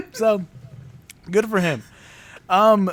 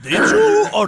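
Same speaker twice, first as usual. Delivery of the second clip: menacing Belschnickel; 11 semitones higher, 3 dB louder than usual. Pretend. Belschnickel interrogates child.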